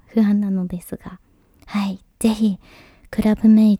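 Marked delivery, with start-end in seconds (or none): none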